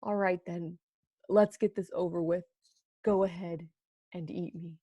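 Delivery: soft, fading, resigned mumble; defeated mutter. Acted. Crushed donut tells the man to go ahead, resigned mutter. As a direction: give up and let the words sink away. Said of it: "and muttered".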